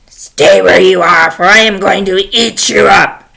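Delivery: loud, threatening troll voice; menacing, forceful troll order; horrible troll. Acted. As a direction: boom out the threat like a monster. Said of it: "there was this horrible troll".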